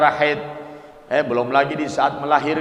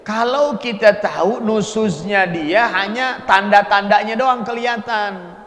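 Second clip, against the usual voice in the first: ordinary talk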